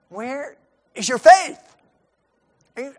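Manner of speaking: ordinary talk, nothing marked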